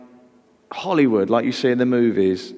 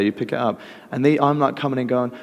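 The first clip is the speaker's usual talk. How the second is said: ordinary delivery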